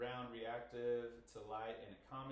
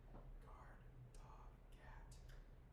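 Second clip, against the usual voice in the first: soft unvoiced whispered words; whispering; 11 dB quieter, much slower than usual. Shown as a demonstration. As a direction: whisper each word softly for a hearing test. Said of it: "I'm just gonna whisper three words".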